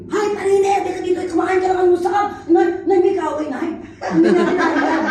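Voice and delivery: loud shout as if a quarrel broke out; Batangas family shouting. Retold or imitated. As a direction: yell across the house like a Batangas in-law calling to breakfast. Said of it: "May mga built-in mic yun eh".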